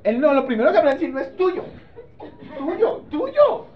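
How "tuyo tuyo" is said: mock child voice. Mocks a child saying yours.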